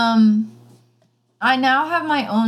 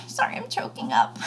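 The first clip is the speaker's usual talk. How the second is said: mock choked up; 10 semitones lower, 8 dB quieter, faster than usual. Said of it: "I'm just kidding".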